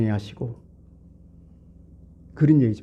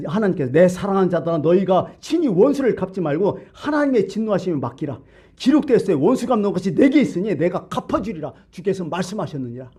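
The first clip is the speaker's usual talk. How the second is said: raised, ringing preacher's quotation; declaimed scripture; 6 semitones higher than usual. Real, not acted. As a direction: proclaim God's word with a lifted pitch. (높은 음으로 성경 말씀을 선포하듯)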